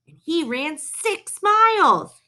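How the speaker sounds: high, drawn-out amazement; impressed. A narrator's reading. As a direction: marvel at the feat, slowing right down. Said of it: "He looked impressed".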